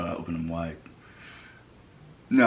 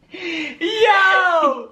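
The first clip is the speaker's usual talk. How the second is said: shout; 5 dB louder than usual. Real, not acted. Being tickled, yelling out.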